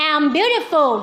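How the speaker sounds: very slow, beautiful drawn out syllable by syllable; slow model phrase. Shown as a demonstration. Teacher modelling a phrase for pupils, very slow. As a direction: model it slowly for the kids to copy. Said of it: "ใครพูดได้".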